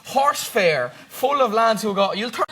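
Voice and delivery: ordinary talk, nothing marked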